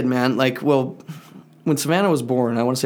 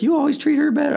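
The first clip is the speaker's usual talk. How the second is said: put-on high-pitched aggrieved kid; imitating mad kid. Son complaining to dad about his sister, high mock-mad voice. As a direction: whine it like a jealous child. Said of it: "he's getting all mad".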